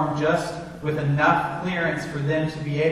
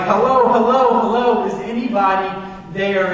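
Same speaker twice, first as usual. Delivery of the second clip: higher, louder, urgent hellos; calling out on the radio; 4 semitones higher, 6 dB louder than usual. Retold or imitated. Pastor calling desperately into the plane radio, loud. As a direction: call into the headset hoping someone answers.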